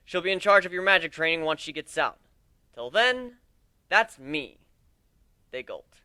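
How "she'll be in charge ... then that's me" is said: addressing a crowd. Acted. Captain introduces crew to recruits.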